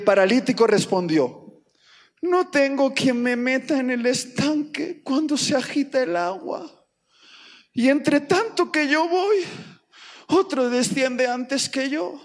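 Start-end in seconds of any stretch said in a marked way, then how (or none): 2.2-12.2 mock self-pitying lament. Paralytic explaining himself to Jesus, mock woeful complaint. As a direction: lament like a poor lost soul. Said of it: "alma en pena".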